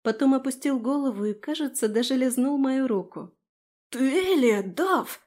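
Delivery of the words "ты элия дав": excited, a bit louder and higher burst; blurted out. A narrator's reading. Frey to Dav on a sudden realisation, blurted out. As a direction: blurt it out in amazement. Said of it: "выпалил Фрей".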